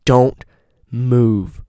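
retold stern command, each word set apart. Friend warning him to freeze, stern. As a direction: order someone to freeze, firmly. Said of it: "in a very stern voice".

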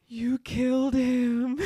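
high, slow, hammed-up wail; overdramatic accusation. Imitating Joyce's big dramatic accusation, hammed-up wail. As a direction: ham up a grieving accusation. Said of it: "really going for it".

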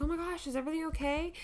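high, fast worried question. Retold or imitated. Mom answering husband's call, alarmed. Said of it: "I was like".

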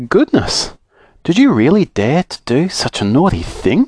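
shocked cry. A narrator's reading. Bessie cries out at Silky's prank, shocked. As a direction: gasp it out, scandalised. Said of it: "cried Bessie".

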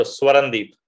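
Swarandeep with a clear W after the S; name pronunciation. Shown as a demonstration. Guest correcting host on the name, demonstrating pronunciation. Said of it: "pronouncing your name right".

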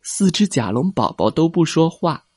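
usual speaking voice

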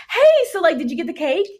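high, breezy "hey" greeting; chirpy roommate. Retold or imitated. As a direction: imitate a roommate asking casually in a high voice. Roommate's casual cake question acted out.

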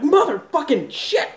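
yelling from the spice burn; retold yell. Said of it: "Just losing my shit".

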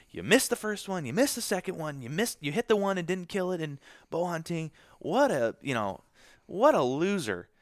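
high, loud self-scolding; self-berating inner voice. Retold or imitated. Berating himself in his head.

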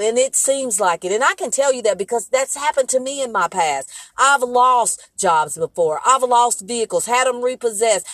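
impassioned testimony to listeners; rising preaching. Acted. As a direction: preach it to the congregation with growing fervor. Preacher builds to exhortation.

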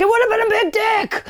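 full-voice comic shout; yelled. Acted. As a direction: belt it out at the top of the voice. Punchline yelled in quotes.

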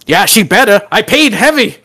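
lightly voiced proud husband bragging; boastful supervisor. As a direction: gloat about the price. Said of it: "He goes".

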